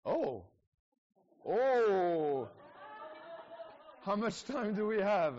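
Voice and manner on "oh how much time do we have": higher and louder, caught off guard; surprised laughing protest. Real, not acted. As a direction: react as if put on the spot. Surprised at being asked.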